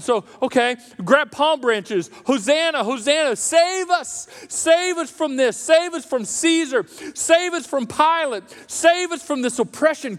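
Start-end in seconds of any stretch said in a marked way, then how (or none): 2.3-10.2 pleading crowd. Retold or imitated. Crowd crying out to Jesus.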